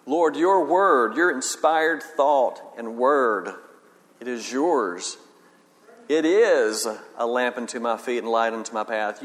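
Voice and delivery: slow, leaning on each word; slow reverent stress on your and word; meditative emphasis. Shown as a demonstration. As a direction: savour every word as a prayer. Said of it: "You emphasize every word".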